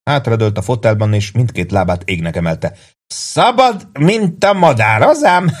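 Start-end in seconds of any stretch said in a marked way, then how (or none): 3.1-5.6 put-on drunken laughing boast. Drunk soldier crowing, laughing.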